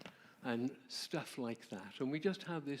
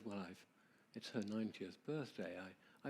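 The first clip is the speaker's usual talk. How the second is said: put-on soft old man; 5 semitones lower, 8 dB quieter than usual. Old man speaks of his wife.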